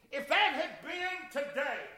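usual speaking voice

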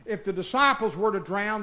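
ordinary delivery

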